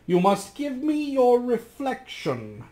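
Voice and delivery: crafty magician. Acted. The magician slyly asks Rasmus for his reflection. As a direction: demand it smoothly, like a scheming sorcerer.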